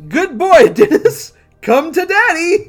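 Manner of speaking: high, loud gloating scientist; gleeful mad scientist. Acted. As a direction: crow over your creation. Mad scientist calls monster.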